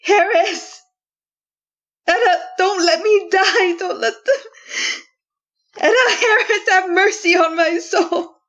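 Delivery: high crying plea for mercy; desperate plea. Acted. Condemned woman calling to the dead, crying plea. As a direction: cry out to the dead, begging.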